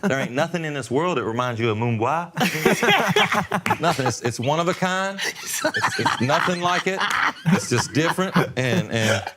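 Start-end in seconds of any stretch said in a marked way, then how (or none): 1.8-3.8 laughing. Real, not acted. Laughing about Mumbai. 5.2-7.0 slow, with laughter running through it; real amused laughter; laughing. Real, not acted. Laughing about Mumbai.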